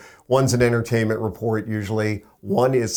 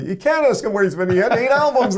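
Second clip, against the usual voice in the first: high, exasperated rebuke climbing in pitch; exasperated scolding; 10 semitones higher, 3 dB louder than usual. Retold or imitated. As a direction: scold him in disbelief, pitching up. Rep scolding on the phone.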